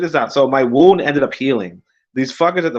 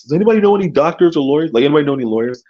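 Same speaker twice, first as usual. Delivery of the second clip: frantic plea; 4 semitones higher, 4 dB louder, much faster than usual. Retold or imitated. Acts out his frantic status post.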